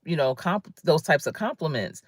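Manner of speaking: normal talking voice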